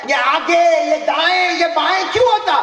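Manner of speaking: high, loud astonished cry; amazed exclamation. Acted. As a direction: marvel aloud at the moving moon. Preacher exclaiming wonder. (ऊँची, ज़ोरदार हैरानी भरी पुकार)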